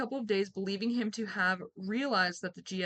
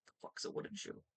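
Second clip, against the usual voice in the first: muttered aside; 14 dB quieter, faster than usual. Real, not acted.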